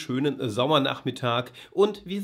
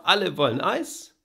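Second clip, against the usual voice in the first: poem recital; 3 semitones higher than usual. Shown as a demonstration. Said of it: "Na, nochmal".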